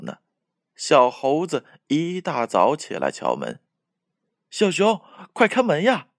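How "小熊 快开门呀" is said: much higher, louder urgent call; anxious calling. A narrator's reading. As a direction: call through the door in a hurry. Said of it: "着急地喊着".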